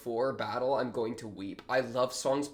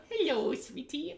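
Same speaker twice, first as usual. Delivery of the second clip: cooing; 12 semitones higher than usual. Real, not acted. Cooing at a cute character.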